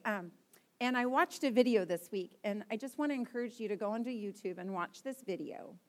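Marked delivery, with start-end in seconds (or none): none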